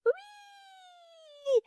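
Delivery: very high squeal; gleeful squeal. Acted. Little hare squeals with joy leaving the zoo. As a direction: squeal with delight. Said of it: "закричало мале зайченятко".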